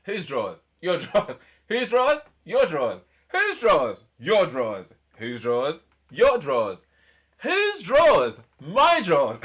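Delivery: loud, high call and response, building; hammed-up shouting. Performing the song's bridge, hammed-up shouted call and response. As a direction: fire each question up high, answer emphatically.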